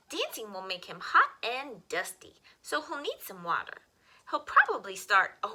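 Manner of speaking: put-on bright, lively read-aloud for children; expressive picture-book reading; storytime reading. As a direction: read it like storytime for little kids.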